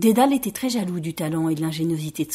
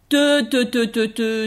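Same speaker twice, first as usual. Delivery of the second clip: mocking; slower than usual. Rabbits mocking the would-be king.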